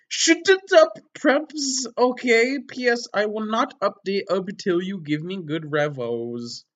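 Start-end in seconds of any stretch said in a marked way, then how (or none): 0.1-5.0 mock outburst. Reading angry author's note.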